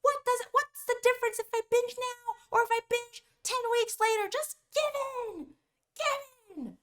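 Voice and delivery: very high, pleading tantrum voice; crazy inner voice. Acted. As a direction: wheedle like a brat, dragging out the last words. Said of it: "It can say all crazy things like".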